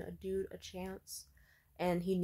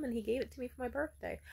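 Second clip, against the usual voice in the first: ordinary delivery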